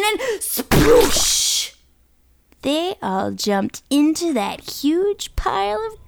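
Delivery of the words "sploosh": imitated sploosh. Imitating jump into garbage.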